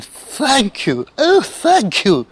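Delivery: high, effusive thanks, slowing down; grateful cry. A narrator's reading. Witch thanks her animal rescuers, crying gratefully. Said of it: "the grateful witch cried".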